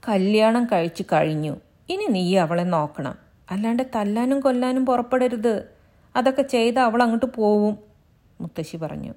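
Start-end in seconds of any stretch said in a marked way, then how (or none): 0.1-7.7 put-on higher, louder old woman rebuking; lightly voiced stern grandmother; scolding grandmother. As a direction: reprove him like an elder. Grandmother advises.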